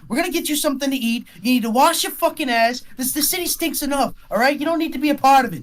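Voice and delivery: high, fast, loud tirade; angry scolding. Acted. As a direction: drag him home while chewing him out. GM voices angry woman scolding.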